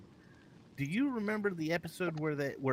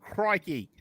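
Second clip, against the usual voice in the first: higher, broad Australian exclamation; mock Australian accent; 4 semitones higher, 4 dB louder than usual. Host wrongly imitating a New Zealander, mock Australian accent. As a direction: put on a cartoonish Australian twang. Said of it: "Isn't that Australian?".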